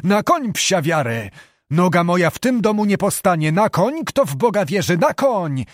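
roaring. Acted. Furious abbot roaring at his minstrels to mount up. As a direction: roar orders at the minstrels. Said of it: "rycząc przy tym jak ranny tur".

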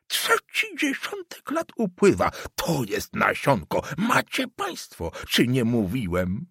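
prophesy doom, jabbing each phrase; agitated old councillor. Acted. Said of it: "wołał".

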